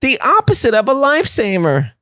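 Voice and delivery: high, stretched-out mock calling; family calling out. Family throwing a paddleboard to a struggling Joe, high mock calling. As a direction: call across the water in a high, slow put-on voice. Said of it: "They go".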